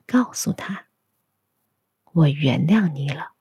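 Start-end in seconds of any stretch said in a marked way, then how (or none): none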